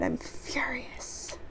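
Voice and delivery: slow, intense whisper; whispered fury. Acted. Worker admits what is inside after apologising, furious whisper. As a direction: whisper it slowly, as a hidden confession.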